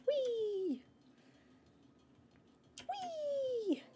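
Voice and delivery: very high, drawn-out squeal; playful squeal as if on a slide; gleeful whee. Real, not acted. Playful cry while moving in game.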